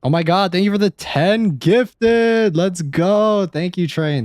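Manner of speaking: high, loud and slow burst of gratitude; excited thanks. Real, not acted. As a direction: gush over the gift. Reacting to ten gifted subs.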